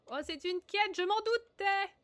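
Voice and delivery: high, loud wail of dismay; real frustrated yelp; exasperated outcry. Real, not acted. Dismayed at discovering a quest.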